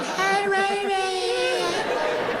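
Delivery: faint drawn-out call from far away; far-off shout. Retold or imitated. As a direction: call her name like it's across the arena. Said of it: "Raven like".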